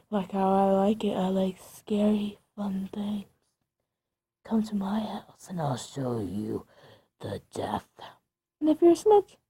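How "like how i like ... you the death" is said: low, soft spooky whisper; creepy whisper. Acted. Ghost whispering to the listener to come to her house, low creepy whisper. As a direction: breathe it like a ghost luring you.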